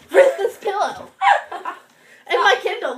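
talking through laughter; laughing. Real, not acted. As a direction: laugh out the words. Laughing over her reading pillow.

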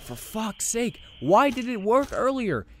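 high, loud exasperated cry; exasperated outburst. Real, not acted. Player baffled the trick stopped working, loud exasperated cry. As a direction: throw the question at the screen.

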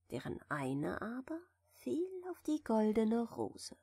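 plain reading voice

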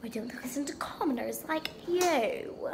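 a haughty, unvoiced whisper; snooty whisper. A snob whispering contempt at a commoner.

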